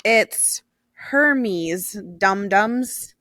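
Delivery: Hermes said HER-meez, slowly drawn out; quoted commenter. Retold or imitated. Reading out a funny comment, slow, deliberate HER-meez on Hermes. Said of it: "and he said".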